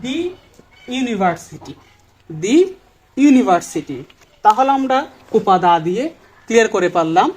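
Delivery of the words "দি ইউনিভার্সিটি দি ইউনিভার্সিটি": "দি university" with "the" said as "দি"; the with di sound. Shown as a demonstration. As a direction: model "the" as "দি" before the vowel. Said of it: "তখন আমরা বলবো". ("the" কে "দি" বলে দেখানো)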